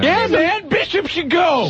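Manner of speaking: high, excited movie quote. Retold or imitated. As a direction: shout the line like an action hero. Quoting Aliens excitedly.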